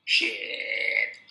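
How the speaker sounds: put-on low, rough drunken mutter; drunk's low mutter. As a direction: sneer it like a drunk sizing him up. Drunk eyes his mustache.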